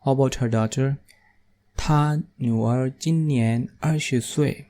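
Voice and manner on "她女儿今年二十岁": slow model reading. Shown as a demonstration. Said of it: "teacher models the sentence".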